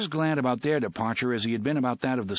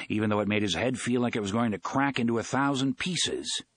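ordinary delivery, nothing marked

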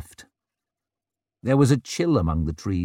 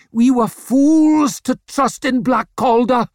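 a high, full-voiced accusing shout; furious shout; 14 semitones higher, 7 dB louder than usual. A narrator's reading. Yort accuses his band of fools, shouted. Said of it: "shouted Yort".

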